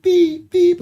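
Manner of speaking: high-pitched mock beeps like a heart monitor. Imitating the delivery-room heart monitor, high mock beeps.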